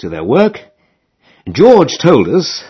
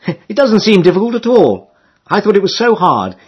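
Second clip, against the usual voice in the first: put-on much higher girlish call; Emily calling; 7 semitones higher than usual. A narrator's reading. Emily asking the rower what he wants, high girlish call. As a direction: ask brightly like a young girl.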